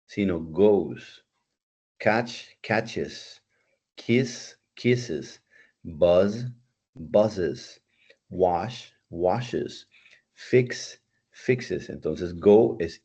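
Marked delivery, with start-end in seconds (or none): 0.1-1.2 goes said slowly, with a clear final z sound; correct goes. Shown as a demonstration. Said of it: "en inglés suena como goes". 2.0-11.9 each verb said, then its -es form with an extra syllable; teacher modelling -es endings for students; teaching -es endings. Shown as a demonstration. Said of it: "una pronunciación un poco diferente".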